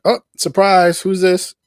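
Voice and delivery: high, loud playful surprise; mock surprise. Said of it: "teasing reveal of villain".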